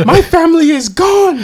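high, anguished movie queen cry; dramatic grieving queen. Retold or imitated. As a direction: overact a grieving mother. Quoting Angela Bassett scene.

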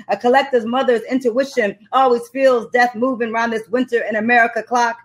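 measured, rhythmic poem delivery; performed poem line; spoken word recitation. Acted. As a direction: lean into each word like a slam poet.

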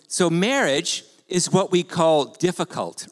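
slow, deliberate restatement; measured, drawn-out delivery. Real, not acted. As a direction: spell it out slowly, as for someone who didn't understand. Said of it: "Let me say it more slowly".

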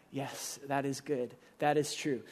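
soft, low, acted affirmation; quiet agreement. Acts out believer's quiet agreement.

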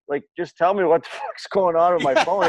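mock-exasperated, laughing mid-line; laughing exasperation. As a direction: laugh through an impatient demand. Retelling frustration at phone store.

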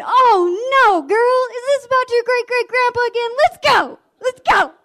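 very high, loud disbelief; exasperated outburst. Retold or imitated. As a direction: imitate a fed-up teacher hauling a kid out. Exasperated Mister Jones removes pupil again.

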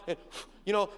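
ordinary talk

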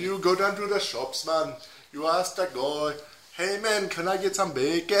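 put-on playful Jamaican accent. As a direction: play a Jamaican giving directions. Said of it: "Give me Jamaican".

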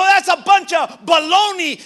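high, loud shout of contempt; shouted scorn. Acted. Preacher as Pharaoh shouting down God's words. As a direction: yell it as a mocking king.

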